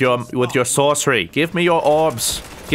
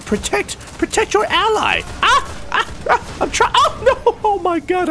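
panicky squeals. Real, not acted. Panicking as his ally dies.

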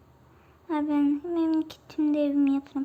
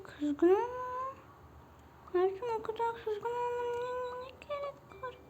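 whiny apology; 4 semitones higher, 3 dB quieter than usual. Pretend. Whiny apology to the angry mob.

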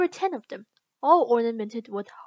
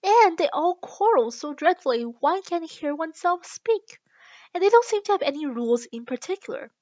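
higher, louder string of grievances; aggrieved, raised-voice protest; complaining; 5 semitones higher, 6 dB louder than usual. A narrator's reading. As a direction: pile up the complaints indignantly. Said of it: "in a rather complaining tone".

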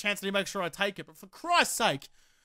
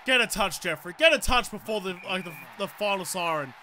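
ordinary talk

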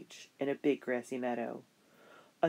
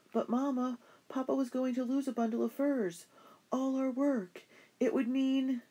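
put-on higher, urgent girlish voice; eager young girl; 11 semitones higher than usual. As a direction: plead your case like a child who meant well. Child character pleading.

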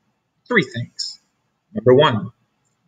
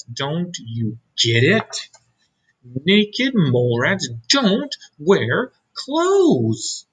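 slow lecturing; 3 semitones higher, much slower than usual. Acted. Mole rats lecture Wilbur.

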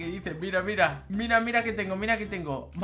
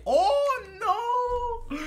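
very high mock wail; lamento fingido; 4 dB louder than usual. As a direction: wail in fake dismay. Finge lamento por la rival. (lamento agudo y fingido)

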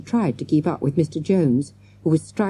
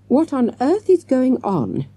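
high-pitched, exasperated demand; 9 semitones higher, 3 dB louder than usual. A narrator's reading. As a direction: demand an explanation, pitch raised. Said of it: "Mister Jones demanded".

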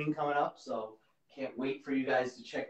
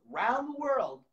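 higher and building excitement; excited; 11 semitones higher than usual. Shown as a demonstration. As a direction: lift it up with excitement. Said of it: "repeats phrase louder".

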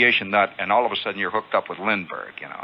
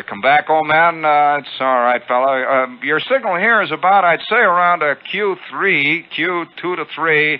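condescending ham operator; 7 semitones higher, 4 dB louder than usual. Retold or imitated. Famous ham grades his signal.

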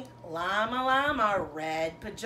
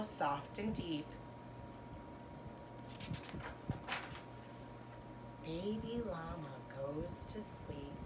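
soft bedtime close; 3 semitones lower, 11 dB quieter, slower than usual. Acted. Bedtime ending of story.

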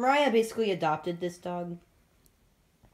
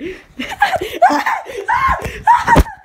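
much higher, louder shrieking laugh; 9 dB louder than usual. Real, not acted. Laughing hard at the friend, shrieking.

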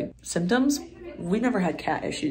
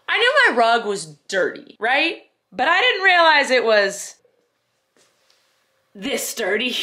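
higher and louder in disbelief; shocked; 5 semitones higher, 5 dB louder than usual. Real, not acted. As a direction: react to the filthy rug with disbelief. Shocked at dirty rug.

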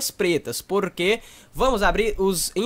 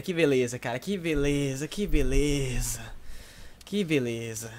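sarcastic; 6 semitones lower, 7 dB quieter than usual. Real, not acted. Sarcastic after bad pull.

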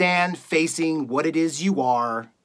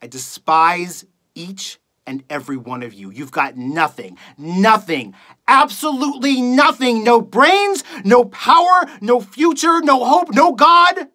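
contemptuous build. Acted. Radio host despises every listener, contempt building to fury. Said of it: "reading a monologue for you guys".

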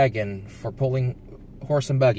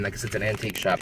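ordinary talk

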